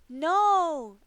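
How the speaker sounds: sharp high shout of no. Acted. Anna throws herself before her sister, shouting. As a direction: yell it to stop the sword. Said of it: "Anna shouted".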